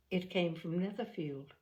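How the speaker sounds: ordinary delivery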